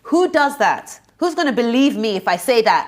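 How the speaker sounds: high, loud, indignant questioning; furious reproach. Retold or imitated. Reenacting confronting the friend who kept the secret, furious. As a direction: demand answers, voice rising in outrage. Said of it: "I was so angry".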